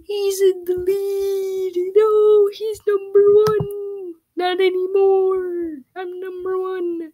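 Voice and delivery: high, slow, gloating put-on voice; mock gloating. Player voices a gloating new leader, high and slow. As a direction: crow it slowly in a silly high voice.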